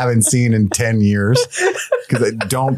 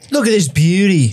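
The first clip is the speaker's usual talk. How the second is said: higher-pitched Aussie parody; hammed-up Australian accent; cartoonish Australian; 8 semitones higher, 3 dB louder than usual. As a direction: show it off in broad Australian. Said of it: "a cartoonishly Australian".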